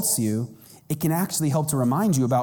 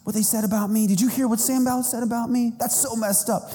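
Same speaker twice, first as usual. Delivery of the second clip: higher, fast petulant complaint; mock immature whining; 6 semitones higher, faster than usual. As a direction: pretend to be hurt and immature. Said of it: "walking around like me, all immature".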